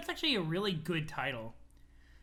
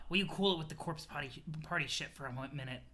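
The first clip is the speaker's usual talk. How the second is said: low, fading softer aside; subdued rebuke; 4 semitones lower, 4 dB quieter than usual. Acted. Character cooling Aradia's party talk, low rebuke. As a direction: rein them in, low and quiet.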